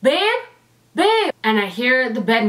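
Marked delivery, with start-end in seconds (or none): 0.0-1.3 higher, lightly voiced calling out; a hint of boyfriend calling out in alarm; worried boyfriend calling. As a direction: mimic him calling from the other room. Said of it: "Cameron in the other room go".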